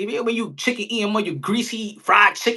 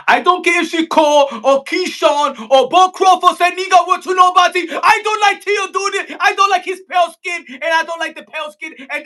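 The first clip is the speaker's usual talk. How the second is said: a higher, louder mock tirade; 1 mock shouting rant; 9 semitones higher, 5 dB louder than usual. Mocking Punch's rant about Tio, a higher, louder mock tirade. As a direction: shout it like you're fake-furious.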